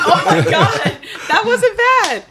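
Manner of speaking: high, laughing. Real, not acted. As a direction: laugh through it. Laughing at the attempt.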